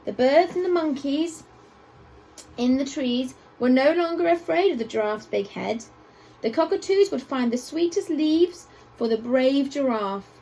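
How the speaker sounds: usual speaking voice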